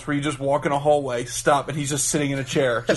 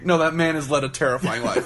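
normal talking voice

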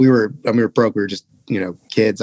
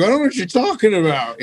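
feigned innocence; 8 semitones higher than usual. Retold or imitated. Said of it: "I was like".

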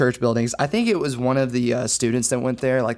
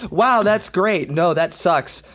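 imitating the kid, pitched up; kid's supposed tic; 5 semitones higher, 5 dB louder, slower than usual. Recapping the kid's routine to friends, imitated tic. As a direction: run through the kid's abrupt switch. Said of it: "That's his entire tics".

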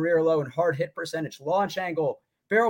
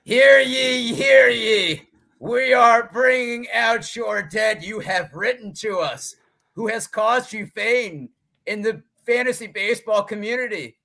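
town crier; 7 semitones higher, 6 dB louder than usual. Pretend. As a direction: announce it like a medieval town crier. Mock proclamation opening Bring Out Your Dead.

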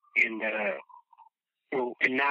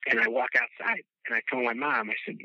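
ordinary talk

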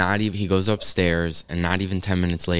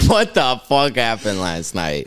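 slow, drawn out through laughter; laughing disbelief; 2 semitones higher, 4 dB louder, slower than usual. Retold or imitated. Teen waking baffled after smoking, retold with laughing disbelief. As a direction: retell the dazed question, cracking up. Repeats dazed line while laughing.

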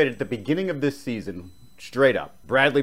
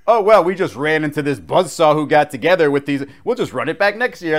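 mock excuses; 5 semitones higher, 6 dB louder than usual. Imitating Bulls making excuses.

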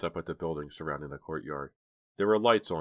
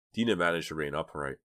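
ordinary delivery, nothing marked

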